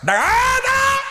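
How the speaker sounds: sudden very high yell; robber's shout. Acted. Robber ordering the traveling boy to halt, loud high yell. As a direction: bark the order to stop.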